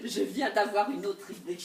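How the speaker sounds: usual speaking voice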